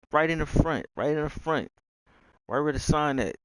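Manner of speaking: acted-out insistent reply; insistent directions. Acting out giving meet-up directions.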